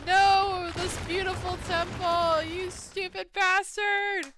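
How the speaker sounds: high, loud furious cry, last words slow; outraged shout. Real, not acted. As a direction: curse him for wrecking the temple. Outraged at the temple's destruction.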